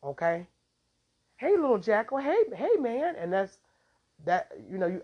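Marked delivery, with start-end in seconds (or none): 1.4-3.2 higher, louder, sing-song hello; naive sweet greeting. Retold or imitated. Fooled person greeting a jackal, high sweet hello. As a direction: coo at it like a pet.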